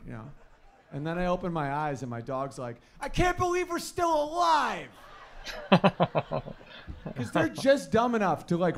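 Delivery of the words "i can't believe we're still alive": high, loud, slow overjoyed dog; excited dog voice. Retold or imitated. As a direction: shout it like a dog thrilled to be alive.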